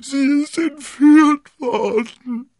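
slow, very high loud wail; hammed-up sobbing husband. A narrator's reading. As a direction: wail it out, dragging every word. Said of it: "schluchzte Bonacieux".

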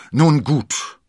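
loud, high, scornful concession; contemptuous. A narrator's reading. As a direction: concede it with open disdain. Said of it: "sagte er verächtlich". (laut und verächtlich)

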